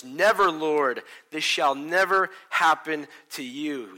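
loud, slow, forceful rebuke; angry yell. Retold or imitated. Peter rebuking Jesus, retold by the pastor as an angry yell. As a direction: rebuke him with all you've got. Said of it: "he's, like, yelling and he's angry".

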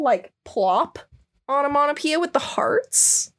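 ordinary delivery

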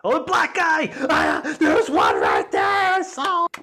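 loud, high excited yell; excited shout. Pretend. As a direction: shout it like spotting something rare. Mock excited spotting.

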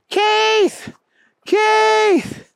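very high, stretched-out calls; yelling for help. A narrator's reading. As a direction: yell for your buddy in a high voice. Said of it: "Booger was yelling".

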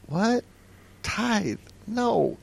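high, soft, isolated words; small high-pitched voice of dawning disappointment; stunned disbelief. Retold or imitated. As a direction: let each word hang in dismay. Retells dismayed disbelief at memo.

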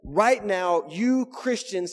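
slow down and hit "you" hard; emphatic. Retold or imitated. Preacher voicing Jesus to Christians, emphatic on YOU. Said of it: "speaking emphatically to us".

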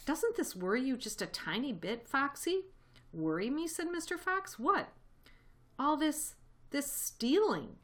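a troubled friend asking gently; Badger's worried voice.

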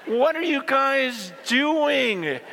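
slow, drawn-out exasperation; bewildered, dragging each word out; exasperated Paul. Retold or imitated. Paul asking the divided church, slow and exasperated. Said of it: "Paul's just going".

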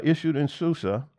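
normal talking voice

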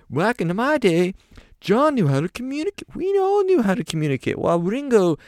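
very high British grumble; old rocker ranting, way up high. Retold or imitated. [name] rants about communication.

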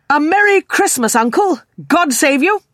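bright-spirited, high, loud greeting; cheerful cry. A narrator's reading. Nephew greeting his uncle at Christmas, cheerful. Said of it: "cried a cheerful voice".

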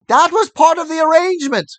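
high, loud and fast cry; cried out. A narrator's reading. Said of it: "cried Squealer".

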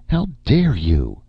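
high, slow, indignant; outraged. A narrator's reading. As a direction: demand it, high and appalled. Erika outraged at Nick.

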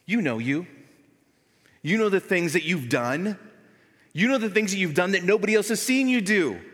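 loud, driving sermon voice; fiery preaching. Acted. Pastor urging the church to leave the world, loud preaching. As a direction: preach it hard to the congregation.

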